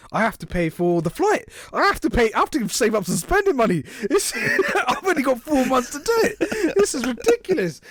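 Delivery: spiral into money panic; rising panic. Retold or imitated.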